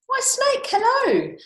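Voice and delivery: very high, loud sing-song greeting; high storybook mouse hailing a friend. Acted. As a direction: call out hello in a piping voice.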